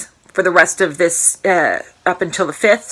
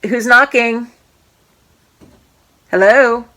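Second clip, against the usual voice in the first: raised voice toward the door; loud call to the next room; 4 semitones higher, 4 dB louder than usual. Real, not acted. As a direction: call out to whoever is knocking. Calling to someone at the door.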